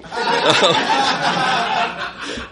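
long, high burst of laughter; laughing at his own punchline. Real, not acted. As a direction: crack up at the ending. Laughs at end of story.

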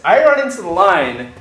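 usual speaking voice

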